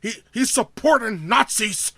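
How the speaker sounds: mock outrage, pitch rising high; outraged nut job. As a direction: imitate a nut job making an accusation. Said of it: "all these nut jobs being like".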